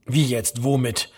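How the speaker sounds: understated self-annoyance; annoyed at himself. A narrator's reading. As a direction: lose the thread, a little cross. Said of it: "ärgerlich über sich selbst".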